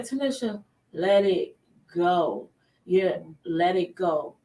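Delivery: slow, measured self-talk; self-calming. Retold or imitated. Tells herself to calm down.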